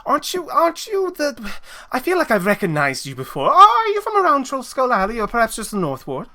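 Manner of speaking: high-pitched, halting surprise; eager, high-voiced questioning; excited recognition. Excited wife recognises the visitor.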